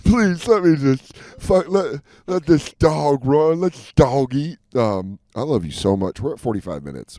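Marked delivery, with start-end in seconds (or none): 0.0-4.6 loud, laughing, slow pleading; excited pleading. Pretend. Begging to joke, laughing.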